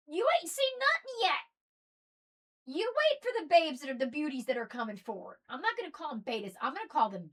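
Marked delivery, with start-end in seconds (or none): 0.1-3.8 teasing hype. Real, not acted. Hyping the coming generation.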